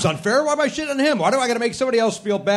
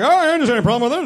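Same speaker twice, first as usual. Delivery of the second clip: a hint of an old radio announcer, fast and bright; mock Matt as a forties announcer; lightly voiced vintage radio man; 6 semitones higher, 5 dB louder, faster than usual. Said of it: "forties radio announcer".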